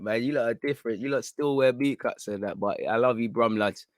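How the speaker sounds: ordinary talk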